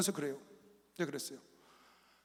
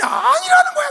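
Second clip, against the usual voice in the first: high, loud, incredulous; incredulous exclamation; 9 dB louder than usual. Real, not acted. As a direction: say it as if you can't believe it. Incredulous retelling. (어이없어 높이 외치듯)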